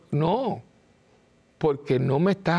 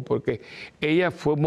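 ordinary delivery

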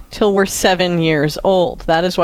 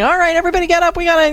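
put-on much higher, hurried rousing call to the kids; brisk wake-up call; 10 semitones higher, much faster than usual. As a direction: herd the kids out of bed. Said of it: "like, saying".

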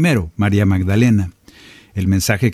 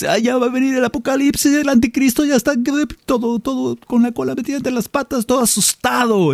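much higher, fast, hammed-up panicky voice; mock scared believer; 13 semitones higher than usual. Preacher mocking fearful believers about the apocalypse, high hammed-up panic. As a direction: squeak it out like a frightened doomsayer.

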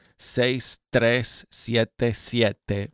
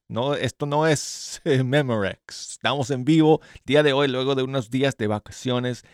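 ordinary talk, nothing marked

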